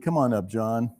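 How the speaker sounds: ordinary delivery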